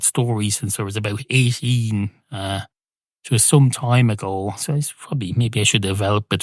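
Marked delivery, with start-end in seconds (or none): none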